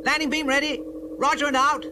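put-on boy answering his alien friend; eager boy voice. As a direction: answer like a keen young radio operator. Booster replies.

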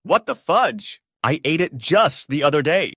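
loud, high outraged yelling; angry high-pitched tirade, easing down at the end; screaming. A narrator's reading. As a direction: insist furiously at a shout. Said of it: "again screaming".

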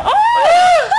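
sudden shout into laughter; laughing shriek. Real, not acted. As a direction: yelp then burst out laughing. Bra strap snaps, shouts laughing.

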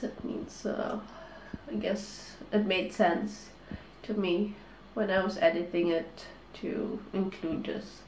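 quiet, tearful and sniffly; holding back tears, voice thin; snotty crying. Real, not acted. Tearful late-night vlog sign-off.

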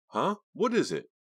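startled. Acted. Startled boyfriend.

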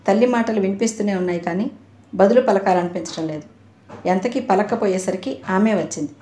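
ordinary delivery, nothing marked

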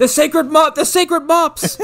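high, loud parody scream; Mark Hamill. Retold or imitated. Riffing on the screamed movie line, high mock scream. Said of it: "you just hear Mark Hamill screaming".

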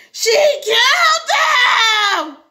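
high, long, wailing yell. Acted. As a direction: yell it out to the whole restaurant. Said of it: "he yelled".

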